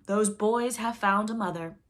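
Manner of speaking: loud, slow, impassioned declaration; passionate cry. A narrator's reading. Pirate captain reveals the boys' mother, passionate loud cry. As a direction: proclaim it slowly and loudly, with passion. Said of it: "he spoke passionately".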